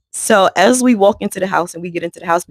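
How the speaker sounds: usual speaking voice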